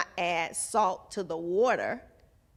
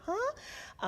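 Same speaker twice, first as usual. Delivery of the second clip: confused huh; 7 dB quieter than usual. Retold or imitated. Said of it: "it sounds like".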